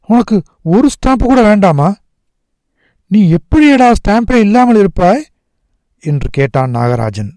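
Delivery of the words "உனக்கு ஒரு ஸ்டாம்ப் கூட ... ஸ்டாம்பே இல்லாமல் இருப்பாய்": high, urgent questioning; incredulous boy. A narrator's reading. Nagarajan asks how his friend can manage, high and incredulous. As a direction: press him in disbelief, pitched high.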